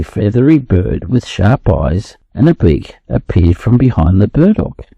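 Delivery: ordinary delivery, nothing marked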